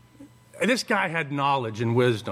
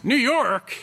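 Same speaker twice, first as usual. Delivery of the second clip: New York? thrown out higher and louder in disbelief; mock shock at New York?; incredulous; 7 semitones higher, 4 dB louder than usual. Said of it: "And I'm like".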